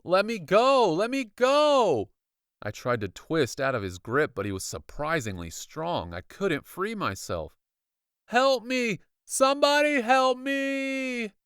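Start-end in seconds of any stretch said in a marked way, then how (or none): 0.0-2.1 high, loud, desperate; panicked shout. Acted. Trapped boy yelling at the doctor holding him. 8.2-11.3 high, loud scream; screaming for help. Acted. Boy screaming for help over the pianos. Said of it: "I cried, screaming over the roar".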